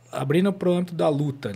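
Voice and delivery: ordinary talk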